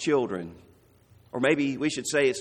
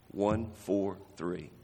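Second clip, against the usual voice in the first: a fond quiet 143; soft slow one four three; 8 semitones lower, 7 dB quieter, slower than usual. Shown as a demonstration. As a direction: lower and softer, slow. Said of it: "name these numbers".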